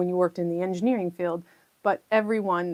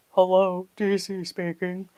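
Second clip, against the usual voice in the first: slower, put-on lower phone greeting; mock deeper voice; slower than usual. To a caller expecting a man, a joking mock deep voice. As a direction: answer again in a fake deep voice. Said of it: "I expected to hear a deeper voice".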